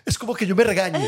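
high, words breaking into laughter; laugh-cry through it. Real, not acted. Cohost reacting to the self-scolding boss, laughing.

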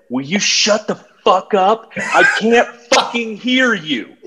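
high, slow, strained snap; angry retort. Retold or imitated. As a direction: reenact snapping at the wife. Yelling at wife mid-workout.

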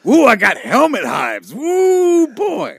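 mock shout.

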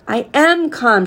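understated narrated scream; mild scream. A narrator's reading. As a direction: protest it with contained exasperation. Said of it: "she screamed".

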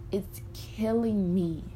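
normal talking voice

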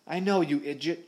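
exasperated captain's shout. A narrator's reading. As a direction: shout back at the fool. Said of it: "shouted Stash".

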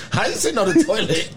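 laughing quote. Retold or imitated. Retold reply, laughing.

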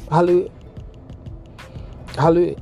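ordinary talk, nothing marked

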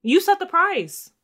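high, loud, drawn-out accusation; indignant retort. Acted. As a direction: throw the blame right back, loudly. Acted retort blaming the man.

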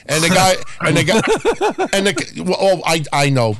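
ordinary talk

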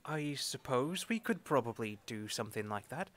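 whispered aside. Real, not acted. Player whispering a suggestion to the table. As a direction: murmur it under the breath, no voice.

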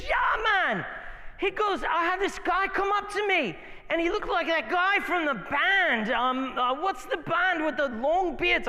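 high, playful Afrikaans-British imitation of friend; Afrikaans British accent. Pastor retelling friend's prison story in an Afrikaans-British accent. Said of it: "Afrikaans slash British accent".

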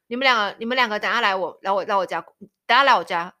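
stern mother-in-law. Retold or imitated. Mother-in-law summoning the couple, loud and stern. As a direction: order them over like a strict mother-in-law. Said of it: "然后很严肃的说".

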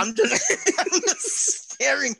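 high, breaking into laughter mid-word; laughing. Real, not acted. Storyteller describing his burger-table stare-down, laughing helplessly high. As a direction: laugh through it, stretching the words.

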